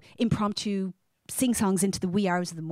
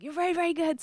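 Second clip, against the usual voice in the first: imitating colleague; 9 semitones higher, faster than usual. Colleague praising at karaoke.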